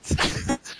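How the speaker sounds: squeaky dolphin chirp; dolphin noise. Pretend. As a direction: do a dolphin call. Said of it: "That's my dolphin noise".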